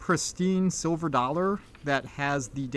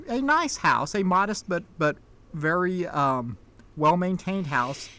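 normal talking voice

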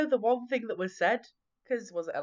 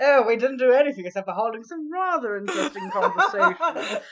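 louder posh gent through laughter; old-fashioned gentleman; 4 semitones higher, 6 dB louder than usual. Retold or imitated. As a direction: play a laughing Victorian gentleman. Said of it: "talk like an old-fashioned gentleman".

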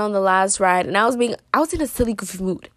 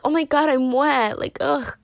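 mock whiny; 6 semitones higher than usual. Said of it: "trying to be, like, funny".

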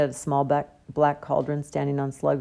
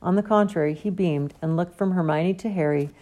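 ordinary delivery, nothing marked

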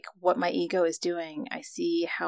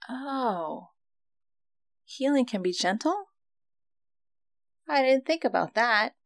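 surprised realization; 6 semitones higher than usual. Retold or imitated. Realization at friend's words.

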